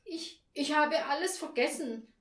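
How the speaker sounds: high, halting, embarrassed confession; ashamed admission. A narrator's reading. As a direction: stumble into admitting it. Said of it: "Sie blickte beschämt zu Boden".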